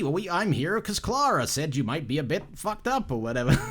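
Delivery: badly faked American accent, friendly stranger greeting. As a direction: put on a clumsy American bloke's voice. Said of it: "That's a really bad accent".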